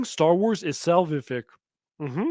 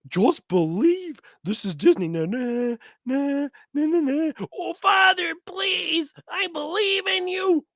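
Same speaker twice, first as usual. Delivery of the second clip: mock Disney hero; 11 semitones higher, slower than usual. Parody Disney scene, hummed tune.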